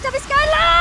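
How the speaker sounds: high, loud caster scream; hype shout. Real, not acted. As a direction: scream the name out. Caster hype call in teamfight.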